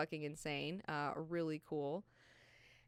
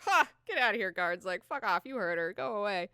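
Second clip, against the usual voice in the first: mock dismissive; 3 semitones higher, 8 dB louder than usual. Faradin dismissing guards.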